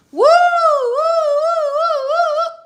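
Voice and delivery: very high, loud whooping cheers; roller coaster whoops. Pretend. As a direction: scream woo like you're on the drop. Acting out a roller coaster ride.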